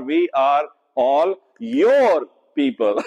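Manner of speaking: "all" drawn out in a slow, deliberate reading; slow stress on ALL. Shown as a demonstration. Teacher to Bible group, rereading the verse slowly with stress on "all". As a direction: take the line slowly and lean into "all". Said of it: "You can emphasize it in, in all those ways".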